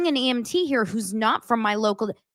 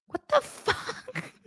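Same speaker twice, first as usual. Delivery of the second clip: very high, slow, laughing what the fuck; real incredulous laugh; laughing disbelief; 13 semitones higher, 7 dB quieter, much slower than usual. Real, not acted. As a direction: let the laugh break through in disbelief.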